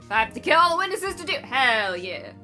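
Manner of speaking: villain voice. Acted. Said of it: "change the killer's voice".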